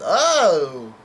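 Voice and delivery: loud, drawn-out mock "oh"; exclaimed reaction. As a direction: exclaim it big, imitating a character. Acting Luffy's exclamation.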